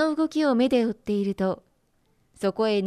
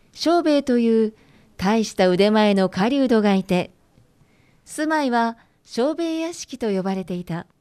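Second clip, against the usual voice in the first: storytelling narration; 5 dB louder than usual. A narrator's reading. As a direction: announce the old tale loudly. Folktale narration opening.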